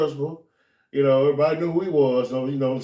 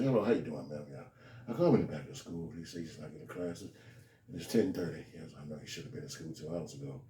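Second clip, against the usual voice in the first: much lower, much softer, measured phone voice; polite principal; 12 semitones lower, 13 dB quieter than usual. Retold or imitated. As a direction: report to the mother calmly.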